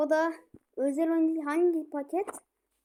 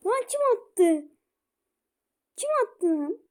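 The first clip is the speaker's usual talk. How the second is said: high, louder asking; surprised disbelief; 4 semitones higher, 4 dB louder than usual. Real, not acted. Gamer stunned by a goal, surprised questions. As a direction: gasp out who scored.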